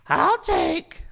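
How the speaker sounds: very high, loud puppet voice; Pinocchio. Shown as a demonstration. Host showing everyday talk in the impression, high loud puppet. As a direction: order food in a piercing cartoon puppet voice. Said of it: "I don't talk normally, like".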